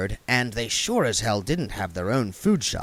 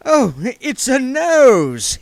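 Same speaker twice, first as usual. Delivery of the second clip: slow, high and loud relief; relieved William; 13 semitones higher, 8 dB louder, much slower than usual. A narrator's reading. William seeing the parsnip, slow relieved exclamation. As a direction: drag it out, weak with relief. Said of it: "he said".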